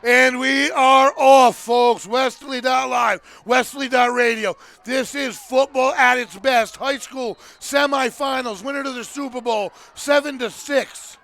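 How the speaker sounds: loud, punchy back-on-air announcement; booming radio sports intro; sports announcer. Real, not acted. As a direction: relaunch the football broadcast with big emphasis. Announcer reopens the broadcast.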